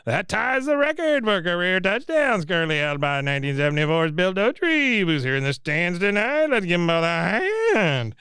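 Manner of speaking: high, straining announcer impression; breathless stadium announcer. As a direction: imitate an announcer gasping through a big moment. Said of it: "you hear the announcer again say".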